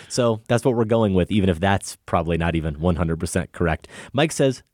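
ordinary delivery

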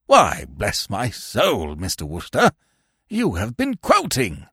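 put-on slow, low old uncle dawning on a discovery; startled old man. A narrator's reading. Old uncle recognising a quotation, slowly astonished. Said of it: "gave a sort of start".

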